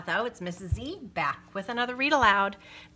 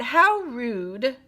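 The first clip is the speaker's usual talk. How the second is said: indignant; 6 semitones higher, 8 dB louder than usual. Acted. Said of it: "To the clerk they said".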